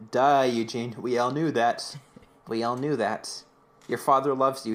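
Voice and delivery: ordinary talk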